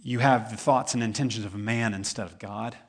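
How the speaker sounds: ordinary talk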